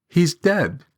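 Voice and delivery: put-on boy voice; lightly voiced boy. A narrator's reading.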